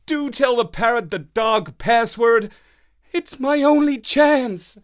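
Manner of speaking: lightly voiced pleading Marlowe; begging dog. A narrator's reading. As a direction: beg with big puppy eyes.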